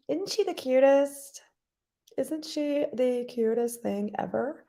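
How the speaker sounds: high, slow cooing voice. Real, not acted. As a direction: coo over the cute little thing. Cooing over a cute animal.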